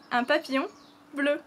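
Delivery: un papillon bleu said uhn pa-pee-YON bleu; un papillon bleu for learners to repeat; French phrase. Shown as a demonstration. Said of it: "our papillon is bleu".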